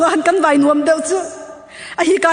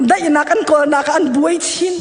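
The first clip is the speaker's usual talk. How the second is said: ordinary talk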